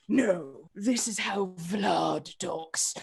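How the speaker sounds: slow, drawn-out put-on character accent; Vlad's voice. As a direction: stretch it out in a stubborn character voice. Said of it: "was doing his voice".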